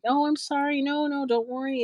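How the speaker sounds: high, hurried reassurance; retold apology. Acts her apologetic reassurance to host.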